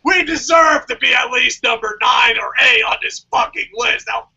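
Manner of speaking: hammed-up outraged shouting; emotional breakdown. Podcaster replaying his upset at Wrestling Ranting, melodramatic shouting. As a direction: yell it like you're emotionally breaking down. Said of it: "I'm, like, emotionally breaking down".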